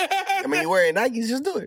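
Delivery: high, loud laughing tease; laughing joke. Real, not acted. Teasing Jeremy about his Nikes, laughing high. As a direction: crack the slogan joke, high up.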